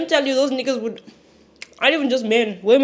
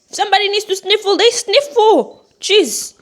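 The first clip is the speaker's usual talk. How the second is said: much higher and louder, the second line slowed; defiant; 9 semitones higher, 9 dB louder than usual. Real, not acted. Answering judgmental viewers, a loud high defiant declaration. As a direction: declare it at full voice. Defending her sniffling loudly.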